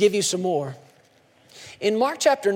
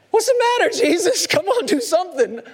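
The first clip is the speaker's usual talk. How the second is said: mock panic; 10 semitones higher, 4 dB louder than usual. Panicked disciples voiced.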